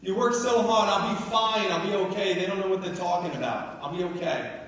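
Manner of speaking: New York mom, loud and high, easing down toward the end; scold, then reassure in a New York accent. Retold or imitated. Mom insisting she'll be okay, emphatic New York accent. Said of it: "In a New York accent".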